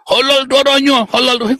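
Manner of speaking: high, repeated cry of mock offence; mock outraged zealot. Opponents threaten him after his joke, high mock outrage. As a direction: parody indignant threats in a high voice.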